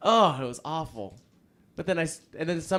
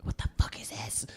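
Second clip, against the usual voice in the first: whispered outrage; 5 dB quieter than usual. Retold or imitated. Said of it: "goes in there, goes".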